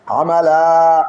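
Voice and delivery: আমালা with the final লা drawn out long and loud; lengthened vowel. Shown as a demonstration. Teacher stretches আমালা for students, long and loud.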